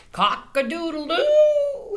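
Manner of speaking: high, loud, slow rooster crow. Acted. Reading to children, a loud high rooster crow. Reading farm animal noises.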